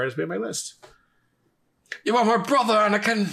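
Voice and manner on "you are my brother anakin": dramatic movie quote. Retold or imitated. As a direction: wail it like a heartbroken mentor. Quoting Obi-Wan from Revenge of the Sith.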